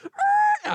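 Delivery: very high loud yell; panicked scream. Retold or imitated. Narrator screaming as he smashes the gas, very high and loud. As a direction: scream while flooring it. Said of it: "and I was like".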